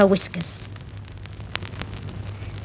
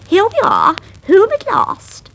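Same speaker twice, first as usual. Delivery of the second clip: Lucy's voice; 13 semitones higher, 5 dB louder than usual. Acted. Lucy arriving home with Miranda, high and proud. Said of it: "said Lucy".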